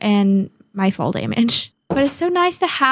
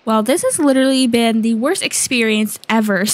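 ordinary delivery

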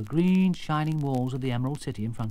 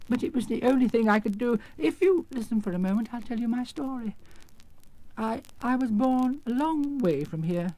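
high, hesitant little-man voice; meek little man; 11 semitones higher than usual. Acted. Exposed wizard beginning his life story, meek. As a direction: plead for a hearing, pitched high.

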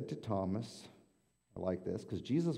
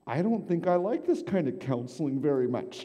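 much higher, louder put-on woman's voice; high whiny patient; 7 semitones higher, 7 dB louder than usual. Patient complaining to her therapist in a high whine. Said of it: "And she's".

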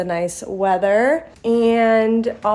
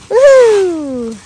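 high, loud whoop of delight; excited cheer; 11 semitones higher, 11 dB louder than usual. Real, not acted. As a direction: cheer the jump like a fan. Cheering son running.

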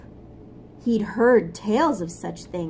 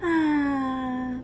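sleepy contented sigh; 4 semitones higher than usual. Acted. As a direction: sigh while dozing. Sleepy ant sighs.

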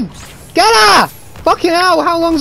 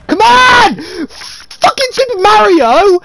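higher, loud furious yell; angry gamer shout; 6 semitones higher, 3 dB louder, slower than usual. Real, not acted. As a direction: yell at the screen in rage. Gamer yelling at the game.

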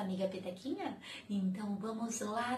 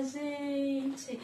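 normal talking voice

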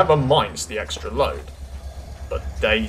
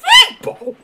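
very high, loud outraged squawk; spluttering; 10 dB louder than usual. A narrator's reading. Daisy is insulted by the shunter, spluttering. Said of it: "spluttered Daisy".

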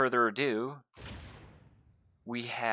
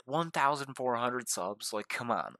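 usual speaking voice

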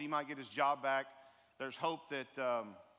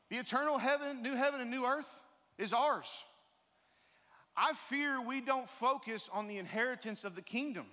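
higher, rising sermon voice pressing the point; preaching; 6 semitones higher than usual. Acted. Pastor urges the congregation about their inheritance, fervently. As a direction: preach it out with growing fervour.

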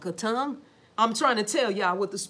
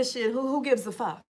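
careless shrug; 3 dB quieter than usual. Retold or imitated. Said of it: "But they say".

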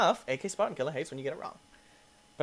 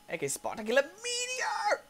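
high announcer voice. Pretend. Announces level name.

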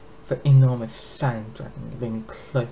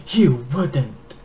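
alarmed protest; 2 semitones higher, 7 dB louder than usual. Acted. Horrified captive dragon protests.

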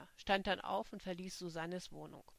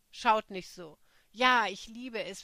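louder, daring them to judge; defiant outburst; 10 dB louder than usual. Acted. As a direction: brush off their stares loudly. Defiant confession to staring friends.

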